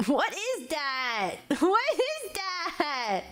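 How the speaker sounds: drawn-out words through laughter; slow, giggly disbelief; laughing question. Real, not acted. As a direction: laugh helplessly while asking, stretching it out. Cracking up at what is read.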